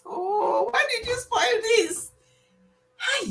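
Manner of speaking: very high, slow mock whine; imitating her complaint. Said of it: "Look at her, she's saying".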